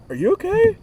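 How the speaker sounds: a mother gently probing her son; concerned mom. Retold or imitated. Imitating mom, high and concerned. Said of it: "but, like, a deep concern".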